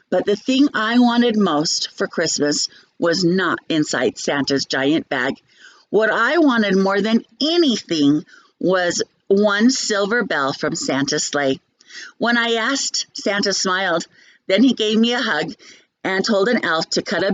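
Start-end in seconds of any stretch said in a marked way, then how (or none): none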